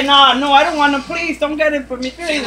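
put-on pleading baby. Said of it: "She, she would say". (súplica fingida de bebé, en voz aguda)

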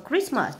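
"Christmas" stressed on "Christ"; first-syllable stress. Shown as a demonstration. Teacher marking word stress, "Christmas" stressed on the first syllable. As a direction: put the weight on CHRIST in "Christmas". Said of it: "là nhấn âm một luôn".

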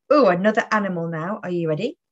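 usual speaking voice

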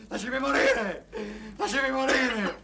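despairing plea. Acted. Drunk man begs friend to let him die, wailing. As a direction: beg desperately to be left to die.

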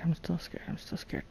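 low, soft murmur fading almost to nothing; scared. Real, not acted. Player after surviving three zombies, scared. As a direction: admit fear under your breath, repeating it. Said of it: "I still am scared".

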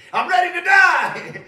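mock despairing prophet, drawn-out and loud; Elijah's despairing plea. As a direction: wail it out like a man begging for death. Preacher voices Elijah's despairing plea.

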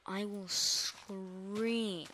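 "scream" stretched out very long; slow right down and drag out "scream"; drawn-out SCREAM. Real, not acted. Threatens to scream if chased.

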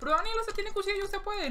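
lightly voiced viewer teasing Daniel; mock viewer. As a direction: play a fan mocking him. Imagined viewer teasing him.

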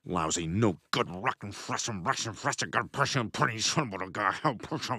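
low, soft mumbled string of fake curses; cartoon profanity. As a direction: grumble nonsense swears under your breath. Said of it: "engaging in cartoon profanity".

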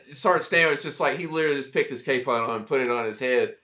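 usual speaking voice

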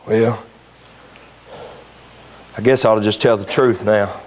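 low, resigned fella talking to himself; reformed liar. Retold or imitated. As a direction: mutter it like a changed man. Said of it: "probably said".

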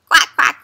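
put-on loud, bright quacking for the kids; playful loud duck call. Shown as a demonstration. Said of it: "Let's make a duck sound".

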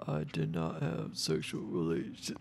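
soft, slow Bill Clinton voice; Clinton impression. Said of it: "I'm really good at the Clinton".

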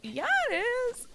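put-on very high kid voice "Yeah, it is!"; little girl. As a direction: chirp it like a tiny kid insisting. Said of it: "She's like".

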